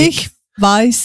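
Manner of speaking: "Ich weiß", each word distinct, v sound in weiß; German teaching demo. Shown as a demonstration. Guest teaching host two German words, careful separated pronunciation. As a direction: model "Ich weiß" for a learner. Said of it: "I'm watching your lips".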